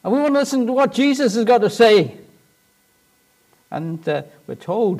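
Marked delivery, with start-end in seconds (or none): 0.0-2.2 high, strained telling-off; high-pitched annoyed crowd voice; crowd hushing someone. Acted. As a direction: hush a noisy man, voice pitched high. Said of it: "they're all saying".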